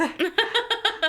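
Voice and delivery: sudden loud high laugh; burst of high laughter. Real, not acted. Laughing at the doll's literal answer, high and loud. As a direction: crack up at the joke.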